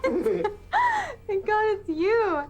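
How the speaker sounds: high tearful relief; crying out. Acted. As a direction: cry out in relief. Relieved cry on seeing Dennis.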